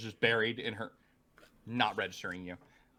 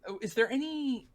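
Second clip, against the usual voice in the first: higher, lightly voiced Amantha; 7 semitones higher than usual. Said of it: "Amantha says".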